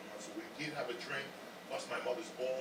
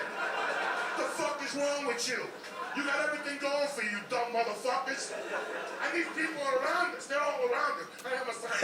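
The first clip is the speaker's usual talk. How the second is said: laughing rant; 11 semitones higher, 16 dB louder than usual. Real, not acted. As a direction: rant at them while cracking up. Comedian ranting about Scientology.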